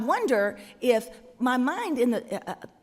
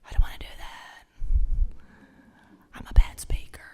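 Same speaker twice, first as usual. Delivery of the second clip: Moses whispering his excuse; breathy reluctant whisper back; 4 dB louder, faster than usual. Acted. Moses whispering back to God, reluctant whisper. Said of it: "Moses whispers back".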